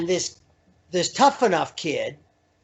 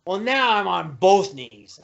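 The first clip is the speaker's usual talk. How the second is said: normal talking voice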